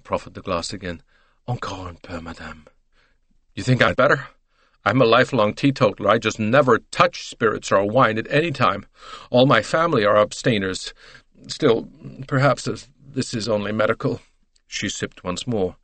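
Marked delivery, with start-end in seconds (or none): 1.5-2.7 soft, low French attendant; hushed, deferential offer; gentle coaxing. Acted. Attendant coaxing lady with glass. 3.5-14.2 emphatic American matron, mildly protesting; protest, then give in; voiced teetotal lady making excuses; fussy American lady. Mrs Hubbard protests over cognac.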